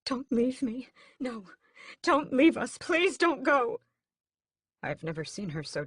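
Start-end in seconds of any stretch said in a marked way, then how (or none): 0.0-3.8 higher, louder, slowing plea; sobbing plea. A narrator's reading. Mother begging her dying son through sobs. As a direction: beg him not to go through tears. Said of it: "sobs against his chest".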